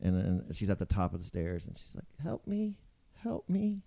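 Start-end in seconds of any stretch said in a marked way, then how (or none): 2.2-3.8 high, soft, small plea; pleading stranger. Retold or imitated. Lady with a baby asks passers-by for help, pleading. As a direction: imitate a timid plea for help. Imitates woman pleading on stairs.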